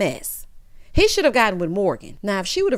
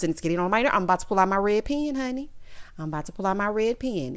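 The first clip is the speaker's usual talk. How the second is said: ordinary talk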